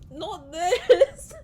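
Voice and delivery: loud teary laugh; real laughing sob; laughing and crying. Real, not acted. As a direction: laugh while tears come. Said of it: "laughing and crying at the same time".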